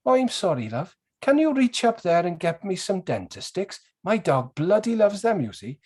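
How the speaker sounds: put-on high, lilting Welsh accent of a little old lady. Little old lady asking a shopper for help, high Welsh lilt. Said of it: "The little old lady was on point".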